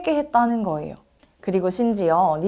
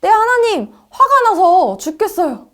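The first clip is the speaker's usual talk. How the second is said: high, loud furious retort; exasperated outburst, voice jumping up high; angry; 11 semitones higher, 7 dB louder than usual. A narrator's reading. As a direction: snap back at God at full pitch. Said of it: "요나는 하나님께 화를 냈어요".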